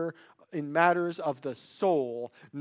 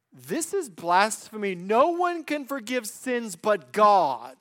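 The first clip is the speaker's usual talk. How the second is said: raised, emphatic accusation, slowing at the end; indignant; 4 semitones higher, 5 dB louder than usual. Acted. Preacher voicing scribes questioning in their hearts, indignant. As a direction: protest it firmly as an outrage. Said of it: "A question of wonder and indignation".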